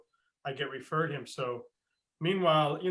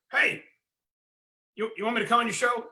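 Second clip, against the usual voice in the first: gruff coach voice; 8 semitones higher, 5 dB louder, faster than usual. Retold or imitated.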